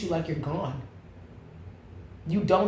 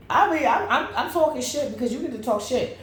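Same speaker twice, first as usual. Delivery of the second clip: ordinary talk, nothing marked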